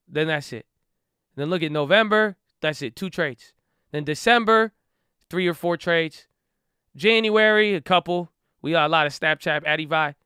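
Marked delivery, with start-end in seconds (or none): none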